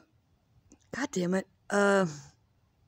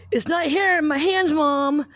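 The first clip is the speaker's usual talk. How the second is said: put-on high, louder impatient kid whine; whiny daughter; 8 semitones higher, 6 dB louder than usual. Mom imitating her daughter complaining to her, whiny high voice. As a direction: mimic an impatient daughter demanding her game. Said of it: "And she's like".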